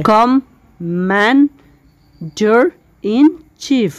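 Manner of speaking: Commander in chief drawn out syllable by syllable; split into syllables. Shown as a demonstration. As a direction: stretch Commander in chief slowly into its parts. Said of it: "আরও কয়েকটা syllable ভাগ করা যায়".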